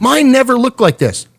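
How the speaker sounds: high squeaky disbelief; a sudden high-pitched wail of envy; amazed squeal. Retold or imitated. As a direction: gasp it out in awe, way up high. Amazed at gooey quesadilla.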